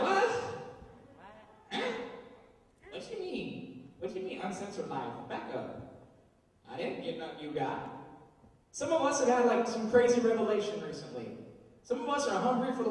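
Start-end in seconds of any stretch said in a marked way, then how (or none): 0.0-7.9 softer, baffled mumble; puzzled, not getting it; confused friends. Retold or imitated. Friends confused by the news, softer baffled mumble. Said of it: "your friends are like".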